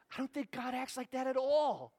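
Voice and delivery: normal talking voice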